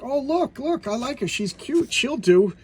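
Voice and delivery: high-pitched mother looking someone over; approving mother. Retold or imitated. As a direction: imitate an eager mom in a high voice. Voicing a delighted mother's approval.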